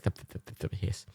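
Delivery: soft unvoiced thup-thup flicks, then a hiss; snake tongue flicks. Imitating the snake Eli, soft tongue flicks. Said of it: "he's like".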